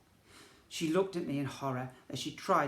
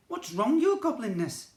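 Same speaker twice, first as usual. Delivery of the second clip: put-on high, louder boy's question; worried question; 8 semitones higher, 8 dB louder than usual. A narrator's reading. Narrator boy asking the angry prince, high and worried. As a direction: ask the prince anxiously.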